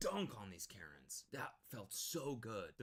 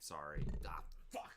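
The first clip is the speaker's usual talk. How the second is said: usual speaking voice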